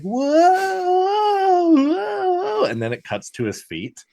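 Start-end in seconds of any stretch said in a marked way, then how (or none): 0.0-2.6 very high, loud, teetering whoa; hammed-up panicked whoa while wobbling; mock wobbling whoa. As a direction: yelp like you're losing your balance. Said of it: "scene where he's like".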